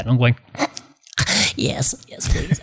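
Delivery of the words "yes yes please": breathless mock-desperate whisper; whispered pleading. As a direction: whisper it as if overwhelmed. Retells his awed, eager acceptance.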